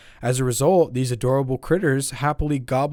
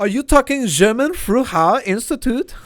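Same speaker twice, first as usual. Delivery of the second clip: German accent; 11 semitones higher, 7 dB louder than usual. Shown as a demonstration. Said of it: "say the name of the institution again in a German accent".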